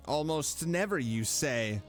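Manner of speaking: ordinary talk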